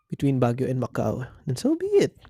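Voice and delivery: normal talking voice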